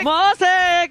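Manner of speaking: loud high yell; shout. Real, not acted. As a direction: echo the yell. Echoing shout. (teriakan keras)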